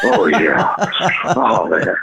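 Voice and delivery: low, breathy mock stoner sigh; stoned bliss. Bandmates smoking like joints, low mock bliss. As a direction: savor it like a joint.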